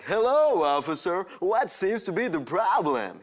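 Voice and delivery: high, loud, drawn-out fake American accent; robotic American accent. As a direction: overdo a stiff American accent for the cop. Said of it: "it sounds very robotic".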